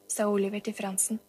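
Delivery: plain reading voice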